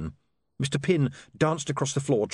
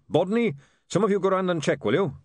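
calling out; 7 semitones higher than usual. Acted. Goodmountain calls to printers.

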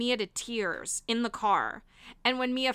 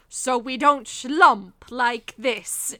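slow, loud mock-haughty teacher voice; quoting Clarice; 7 dB louder, much slower than usual. Friend quoting the grandmother's walking lesson, slow mock-haughty. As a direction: drag it out like a stuffy etiquette coach, laughing. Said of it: "she goes".

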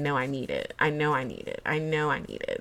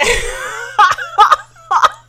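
shrieking burst of laughter; high laugh; 10 dB louder than usual. Real, not acted. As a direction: laugh out loud, high and free.

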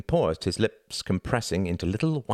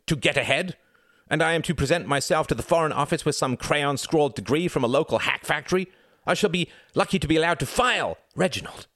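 son railing at his father, louder and higher; indignant rising fury; 9 semitones higher, 4 dB louder than usual. Acted. Son berating his ruined father, loud and indignant. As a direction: pile on the outrage line by line. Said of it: "If you take that tone with me".

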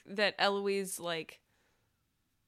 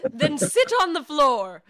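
laughing order; 6 semitones higher, 8 dB louder, slower than usual. Acted. Eloise snaps, laughing.

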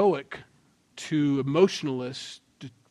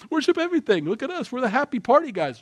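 much higher, louder and fast, laughing through it; giddy party guys; 11 semitones higher, 5 dB louder, faster than usual. Retold or imitated. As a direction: play the carefree partier, giddy and giggling. Mocking Epicureans on the rock.

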